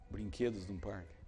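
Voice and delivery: soft, low preacher voice; preacher dropping to a solemn hush; hushed sermon. Acted. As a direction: draw them in quietly. Quiet intimate preaching.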